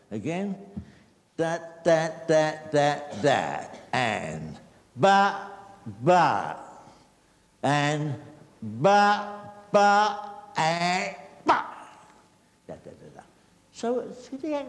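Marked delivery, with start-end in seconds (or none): 1.4-11.7 punchy percussive beat syllables; rhythmic counting-in for a dancer; vocalised dance rhythm. Shown as a demonstration. 12.7-13.2 very soft, low "da" syllables; quiet rhythmic scat; vocalised rhythm. Shown as a demonstration.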